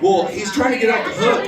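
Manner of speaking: usual speaking voice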